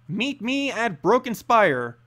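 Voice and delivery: plain reading voice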